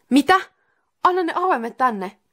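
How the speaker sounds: high, loud, urgent outburst; panicked demand. Acted. Santeri demands the keys from the spirit, in a panic. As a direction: blurt it out in alarm. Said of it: "Santeri sanoi hätäpäissään".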